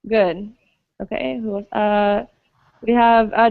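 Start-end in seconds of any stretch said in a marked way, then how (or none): none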